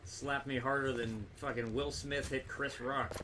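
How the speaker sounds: ordinary talk, nothing marked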